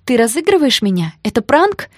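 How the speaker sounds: agitated hope climbing in pitch; painfully hopeful. A narrator's reading. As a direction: ask desperately, hoping it is a joke. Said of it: "с болезненной надеждой в голосе".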